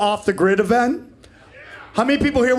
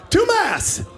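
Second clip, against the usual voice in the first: much higher, incredulous Two masks!; outraged disbelief; 11 semitones higher than usual. Real, not acted. Telling the audience about the woman, a high incredulous cry. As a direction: spit out the outrage.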